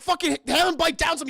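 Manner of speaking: high, loud imitated order; panicked urgency. Crew tries to help convulsing Kane, imitated panic. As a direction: act out a frantic crew helping.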